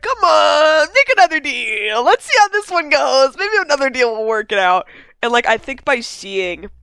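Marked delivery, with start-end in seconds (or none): 0.0-4.9 high, loud, hammed-up wheedling; mock pleading. Podcaster imitating Dutch begging for another deal, hammed-up pleading. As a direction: plead in a stretched-out mock whine.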